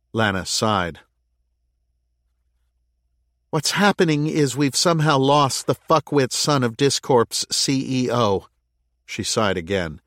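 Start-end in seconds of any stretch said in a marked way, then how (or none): 3.5-8.5 raised, high exasperation; exasperated mayor. Acted. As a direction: vent the frustration. Lana exasperated.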